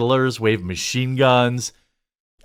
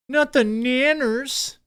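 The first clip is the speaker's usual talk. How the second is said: mock baby talk; 11 semitones higher, slower than usual.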